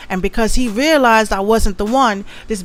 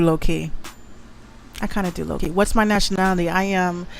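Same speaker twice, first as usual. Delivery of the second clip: normal talking voice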